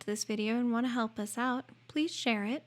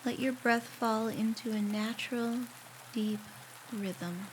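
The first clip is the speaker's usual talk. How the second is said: guided meditation; 8 dB quieter than usual. Acted. Guiding a listener through a shower meditation, calm and unhurried. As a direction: ease the listener gently through each step, leaving space to breathe.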